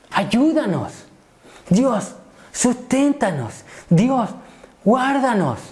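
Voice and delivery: high, slow imploring prayer; crying to God. Acted. Preacher models praying for the church, crying out to God. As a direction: plead upward, each petition drawn out. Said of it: "clamar a Dios y decir".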